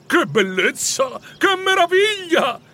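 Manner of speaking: slow, soaring high-pitched delight; joyful exclaiming. Acted. Scrooge clapping his hands for happiness, exclaiming joyfully. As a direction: cry out elated, stretching each word. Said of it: "esclamò, battendo le mani per la contentezza".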